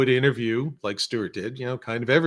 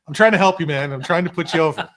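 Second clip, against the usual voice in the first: louder, higher urgent aside to a friend; exasperated plea; 5 semitones higher, 7 dB louder than usual. Retold or imitated. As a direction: push it hard, half-laughing, to help him out. Pleading aside to Stuart on air.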